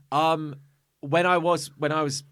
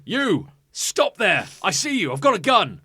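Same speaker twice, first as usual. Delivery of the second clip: stern farmer; 6 semitones higher, 5 dB louder, slower than usual. Retold or imitated. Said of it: "He goes, he goes".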